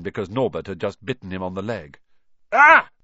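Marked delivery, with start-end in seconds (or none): 2.5-2.9 sudden high, loud cry of pain; sharp startled ouch; pained yelp. Acted. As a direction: yelp as the dragon bites. Bitten on the leg by dragon.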